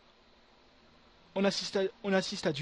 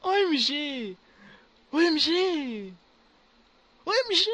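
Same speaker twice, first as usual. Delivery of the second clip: stunned excitement; 8 semitones higher, 6 dB louder, slower than usual. Real, not acted. Streamer still reeling from the drop.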